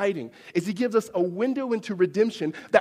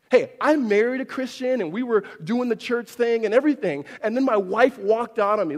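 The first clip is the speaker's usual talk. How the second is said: raised, higher, bitter complaint; a jilted husband venting louder; 5 semitones higher, 4 dB louder than usual. Retold or imitated. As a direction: voice of a husband whose wife left. Voicing an abandoned husband.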